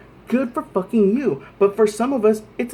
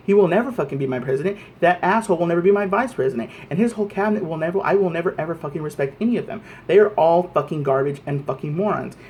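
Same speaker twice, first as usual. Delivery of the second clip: fast angry rant; 3 semitones lower, faster than usual. Real, not acted. Furious rant, rapid repeated nevers.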